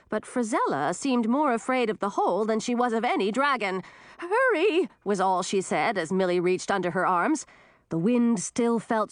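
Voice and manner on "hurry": high, urgent call; urgent cry. A narrator's reading. Old woman to the girl lifting her, urgent cry. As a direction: urge the rescuer on.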